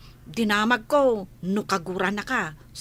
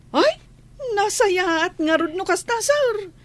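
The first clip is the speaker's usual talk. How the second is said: delighted; 10 semitones higher, 4 dB louder than usual. Acted. Maid delighted at good news.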